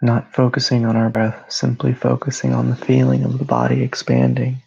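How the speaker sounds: calm, even meditation voice with long pauses; guided meditation. Real, not acted. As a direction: guide them gently into rest, phrase by phrase.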